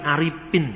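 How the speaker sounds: "Aripin" with a plain p in place of the f; "Aripin" dengan bunyi p, bukan f; Sundanese p for f. Shown as a demonstration. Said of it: "Orang Sunda sulit untuk mengucapkan huruf F".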